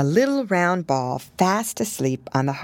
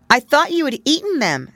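higher, startled; waking suddenly; 10 semitones higher, 5 dB louder than usual. Acted. Pigling wakes and speaks to Pigwig. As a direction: blurt it out half awake. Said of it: "waking suddenly".

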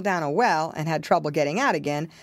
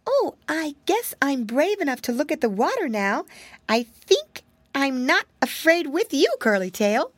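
put-on high, fretful little girl voice; a tiny frightened mouse wailing; small scared mouse girl, pitched up high; timid mousy girl; 7 semitones higher than usual. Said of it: "she said".

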